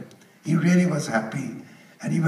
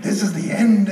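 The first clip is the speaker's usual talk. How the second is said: mock explosion; 4 semitones higher, 7 dB louder than usual. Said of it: "Lou Kahn absolutely exploded".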